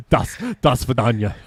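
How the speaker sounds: giggling mock Russian goodbye; laughing Russian accent. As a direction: fake Russian through laughter. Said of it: "I can't do a Russian accent".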